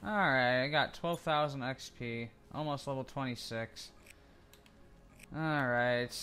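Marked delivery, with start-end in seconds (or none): none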